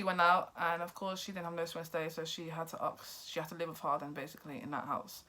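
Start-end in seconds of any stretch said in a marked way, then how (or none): none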